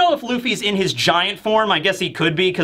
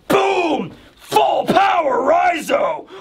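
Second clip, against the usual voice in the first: hyped shout; 10 semitones higher, much slower than usual. Retold or imitated. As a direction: announce the attack like an anime hype. Hyped narration of Raizo attack.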